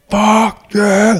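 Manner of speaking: yelling demo. Shown as a demonstration. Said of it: "To me, yelling is like".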